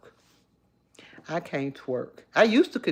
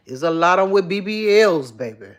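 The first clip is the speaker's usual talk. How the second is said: ordinary delivery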